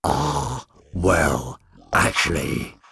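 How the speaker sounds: gruff creature cutting in; gruff, drawn-out interruption. A narrator's reading. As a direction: interject in a rough growly voice. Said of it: "said a gruff voice from the doorway".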